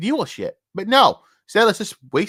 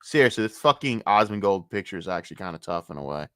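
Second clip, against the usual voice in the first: ordinary talk, nothing marked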